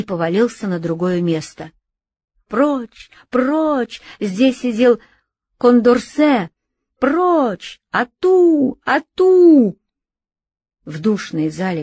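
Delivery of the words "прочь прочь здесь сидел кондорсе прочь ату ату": high, loud, rising yells; frenzied shouting. Acted. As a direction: hound him out with hunting cries. Deputies hounding Robespierre.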